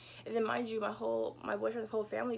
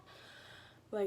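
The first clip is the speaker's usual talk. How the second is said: whisper; 9 dB quieter, much slower than usual. Real, not acted. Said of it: "unvoiced whisper".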